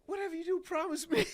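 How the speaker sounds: high, loud pop star impression. Star asking guests for a promise, high imitated voice. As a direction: mimic the star's high-pitched plea. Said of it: "He said".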